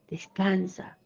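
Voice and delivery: breathy whispered reassurance; soft whispered "descansa". Acted. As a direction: whisper it to calm them. God's soothing reply, whispered.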